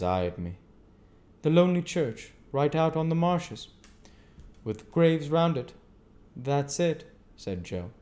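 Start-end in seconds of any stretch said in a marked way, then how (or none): 1.4-5.7 higher, louder stranger's voice. A narrator's reading. Stranger recalls the church. 6.3-7.0 lightly voiced simple blacksmith, a bit higher; a hint of easygoing Joe. A narrator's reading. Joe agrees.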